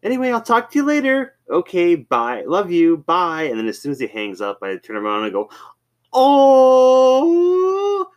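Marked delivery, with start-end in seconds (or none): 0.0-3.4 put-on high, sweet phone goodbye to a kid; doting dad imitation. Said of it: "he was talking to his kid". 6.1-8.1 long, loud, very high "aww"; teasing coo. Retold or imitated. Teasing the softie after his call.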